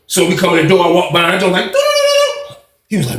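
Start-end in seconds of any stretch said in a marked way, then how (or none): 1.6-2.6 very high doo-doo-doo; innocent humming noise. Retold or imitated. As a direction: trill a high little walking-by noise. Mimes strolling by innocently.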